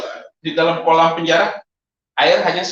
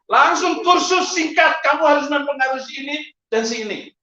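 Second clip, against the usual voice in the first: urgent shouted instruction; 7 semitones higher than usual. Retold or imitated. Preacher drilling the baptized prisoner on whom to convert, shouted urgently. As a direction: fire off instructions like a drill sergeant.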